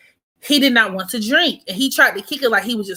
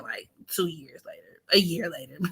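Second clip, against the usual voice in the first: whispered aside; 5 semitones lower, 11 dB quieter than usual. Real, not acted. Telling her friends the story, whispering the correction. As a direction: drop to a breathy whisper for the correction. Hushed aside recalling timeline.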